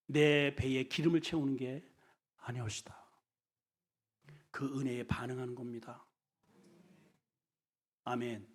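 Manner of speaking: normal talking voice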